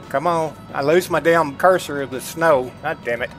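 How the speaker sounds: usual speaking voice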